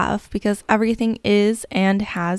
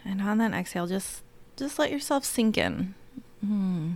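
guided meditation. Acted. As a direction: lull them gently into calm.

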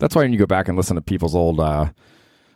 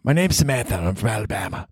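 put-on Southern accent; 3 semitones higher than usual. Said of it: "should have a more deep Southern accent".

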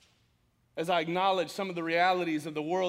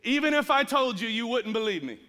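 louder, higher firm answer, a touch of God's authority; 5 semitones higher, 6 dB louder than usual. Retold or imitated. God answering the prophet Habakkuk, firm and raised. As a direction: declare it as God replying, firm. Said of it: "God speaks back to Habakkuk and says".

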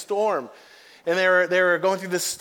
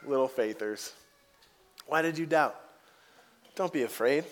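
gentle teasing; 5 semitones lower, 6 dB quieter than usual. Pretend. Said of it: "look at him and be like".

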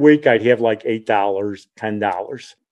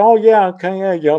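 high, amused mock agreement; higher-pitched chuckling brush-off; laughing retold reply; 7 semitones higher than usual. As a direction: lightly laugh off the scolding, pitched up. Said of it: "so I'm laughing".

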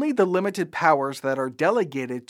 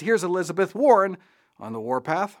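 ordinary delivery

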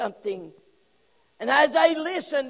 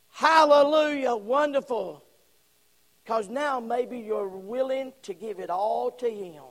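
normal talking voice

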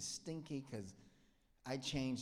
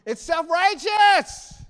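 much higher and louder, slow; preaching shout; 22 dB louder, much slower than usual. Acted. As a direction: hammer the verdict home. Preaching climax.

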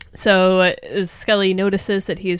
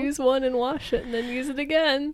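mock pitiful; 6 semitones higher than usual. Big Mike explaining why he needs few dishes, mock pitiful. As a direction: make a meek, sad confession, lightly acted. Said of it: "says the saddest thing ever".

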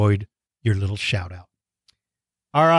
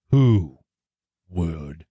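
low, hushed, word-by-word announcement; dramatic segment teaser; 9 semitones lower, much slower than usual. Pretend. Teasing the segment before break.

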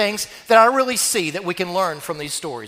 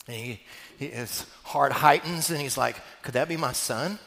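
hushed, softer and lower, breath held; hushed wonder; 8 semitones lower, 10 dB quieter than usual. Acted. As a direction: lean in, almost breathless hope. Father sees figure, hushed wonder.

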